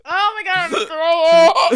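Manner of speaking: loud, high, gagging wail; loud distressed cry. Real, not acted. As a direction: cry out like you'll vomit. Mouth burning from hot sauce.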